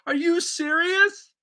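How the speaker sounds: high, slow, stunned question; stunned disbelief. Retold or imitated. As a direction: stretch it out in disbelief. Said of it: "I'm going".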